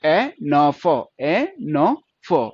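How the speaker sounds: ए... न... फो, very slow and separated, twice over; sounded out. Shown as a demonstration. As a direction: hold each sound apart: ए, न, फो. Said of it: "तो क्या बन गया?". (ए न फो बहुत धीरे, तोड़-तोड़कर)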